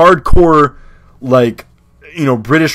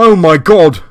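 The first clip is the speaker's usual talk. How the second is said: cheeky higher-pitched British rocker; 4 semitones higher, 4 dB louder than usual. Acted. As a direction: play a brash London rocker. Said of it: "let me know if the voice is a little too much".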